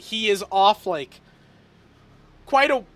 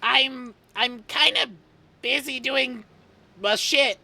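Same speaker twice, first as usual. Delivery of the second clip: busy, distracted voice; 5 semitones higher than usual. GM voices Shit on call.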